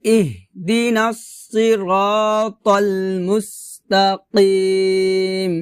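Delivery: "ইহদিনাস সিরাতাল মুস্তাকিম" with heavy sad and throaty qaf; tajweed demo of "ইহদিনাস সিরাতাল মুস্তাকিম", slow and sustained; slow Quran recitation. Shown as a demonstration. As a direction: stretch the long vowels of "সিরাতাল" and "মুস্তাকিম". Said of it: "আবার বলি প্রথম থেকে".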